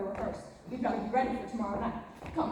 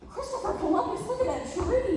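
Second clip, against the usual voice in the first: higher, excited gasp of wonder; amazed; 9 semitones higher, 4 dB louder than usual. Real, not acted. Jo amazed at the tree.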